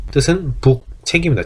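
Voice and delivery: normal talking voice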